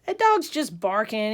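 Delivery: loud, exasperated scoff; disgusted. Acted. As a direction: act out a friend dismissing it in disgust. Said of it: "He walks away disgusted".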